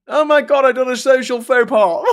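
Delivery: high, loud hammed-up posh gasp; mock posh character. Vlogger mocking a posh film heroine, hammed-up. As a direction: play a fretting toff in a comedy. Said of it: "all the films we make".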